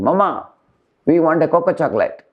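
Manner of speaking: put-on high-pitched childish plea for chocolate; child begging. Children at the mall begging mother, high childish plea. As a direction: play a small child begging Mama for a treat. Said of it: "children are going to a shopping mall and saying".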